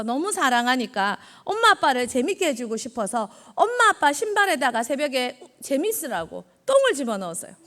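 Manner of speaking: normal talking voice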